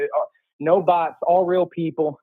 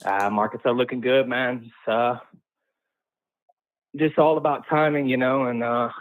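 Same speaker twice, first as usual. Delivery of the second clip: ordinary talk, nothing marked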